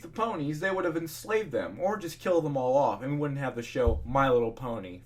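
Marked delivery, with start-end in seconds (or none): none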